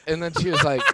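usual speaking voice

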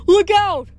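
high, loud sudden warning; yelp. A narrator's reading. Grover warning friends of falling statue, high yelp. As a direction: yelp it out in alarm. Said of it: "Grover yelped".

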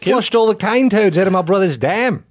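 broad Aussie bloke; Australian accent. Pretend. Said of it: "in an Australian accent for me".

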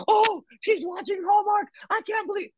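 high, shocked mock outrage; a hammed-up gasp of disapproval; mock scandalized God. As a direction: squeal it like a prudish scold.

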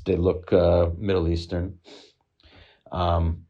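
usual speaking voice

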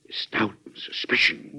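whispered slowly, half to himself; brooding whisper. Acted. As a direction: let the words sink into a hushed worry. President broods aloud to himself.

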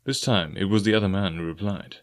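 ordinary delivery

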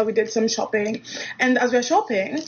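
usual speaking voice

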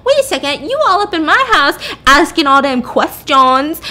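hammed-up drunken indignation; acted freak-out. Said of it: "she starts freaking out".

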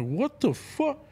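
high, stunned exclamation; shocked. Retold or imitated. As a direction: blurt it out in shock. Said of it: "I'm like".

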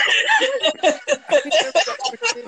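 loud, high laughing shout. Real, not acted. Brother cracking up at the door scuffle, loud laugh.

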